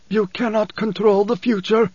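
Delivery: high, slow, effortful preacher's voice; strained whisper, voiced. A narrator's reading. Preacher to Leto in their contest, high and effortful. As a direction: push the words out as if lifting a great weight. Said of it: "the preacher whispered".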